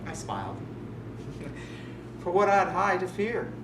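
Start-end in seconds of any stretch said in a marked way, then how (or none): 0.6-2.1 quiet sinister laugh. Acted. Laughs after "I smiled.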